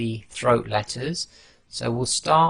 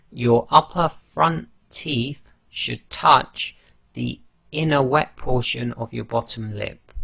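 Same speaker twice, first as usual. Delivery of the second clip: usual speaking voice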